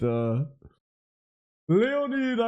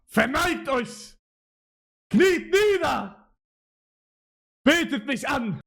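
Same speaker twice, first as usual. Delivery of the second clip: higher, commanding mock ruler; dark lord; 7 semitones higher than usual. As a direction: command them like a self-proclaimed god. Boasting that he can write Japanese.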